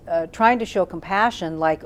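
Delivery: normal talking voice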